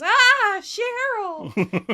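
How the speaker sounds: loud, high mock-lustful cry; forced passion, loud and high; disgust masked as lust. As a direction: turn disgust into a hammed-up swoon. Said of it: "tries to make his disgust into lust".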